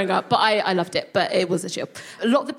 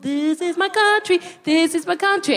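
proud high claim; 8 semitones higher than usual. Retold or imitated. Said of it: "I turned up like".

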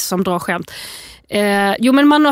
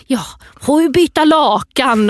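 storybook dad; 5 semitones higher, 4 dB louder, slower than usual. Retold or imitated. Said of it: "precis som Alfas pappa".

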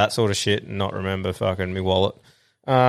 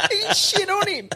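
laughing. Real, not acted. Cracking up at story.

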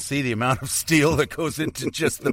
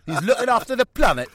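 put-on Geordie accent pitched much higher and louder; a Geordie rock star going on about the planet; 11 semitones higher, 4 dB louder, faster than usual. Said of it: "The old Geordie".

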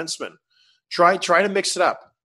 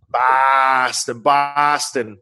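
louder mock Boston accent; 4 dB louder, much slower than usual. Shown as a demonstration. Host repeats the word in a Boston accent. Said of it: "a good Boston accent".

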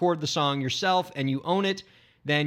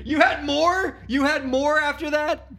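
high, surprised disbelief; shocked disbelief, rising high; 10 semitones higher, 4 dB louder, slower than usual. Real, not acted. Learning partner ate more.

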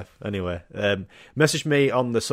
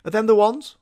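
high-pitched questioning mum; mum impression voice; 10 semitones higher, 4 dB louder than usual. Mum checking the boots after a walk, high questioning voice. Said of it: "your mum's like".

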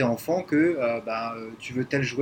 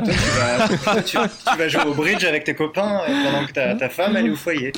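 loud, raised mock-cheerful irony; sarcastic; 2 semitones higher, 6 dB louder than usual. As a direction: ironic teasing. Mock picture of old patriarchy.

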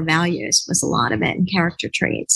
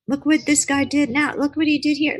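excited tattling; 8 semitones higher than usual. Retold or imitated. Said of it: "I would be like".

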